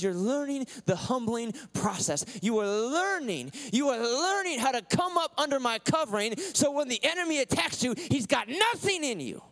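high, loud, stretched preacher's cry; full-force preaching. Acted. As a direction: preach it out, half singing the lines.

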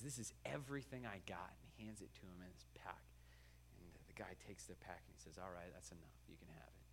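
hushed whisper of a secret deal. Acted. The buyer and seller seal the deal in a whisper. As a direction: breathe it, barely voiced.

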